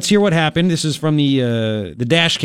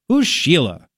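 ordinary delivery

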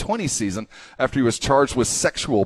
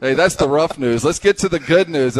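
ordinary talk

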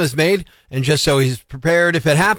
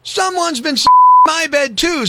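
Mama Bear voice; 9 semitones higher, 4 dB louder than usual. Acted. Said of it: "said the Mama Bear".

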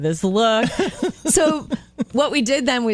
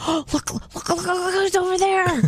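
high, hushed excited nudge; hushed starstruck whisper, much higher; shy starstruck fan; 10 semitones higher than usual. Retold or imitated. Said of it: "those who just go".

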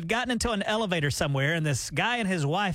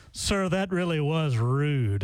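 mock stern stranger; 3 semitones lower, much slower than usual. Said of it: "And the guy goes".

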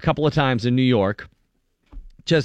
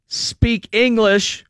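higher, slow mock yell at the driver; angry man yelling at an Uber driver to speak English; retold yelling; 10 semitones higher, much slower than usual. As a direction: bark it slowly like an angry man. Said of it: "screaming at the".

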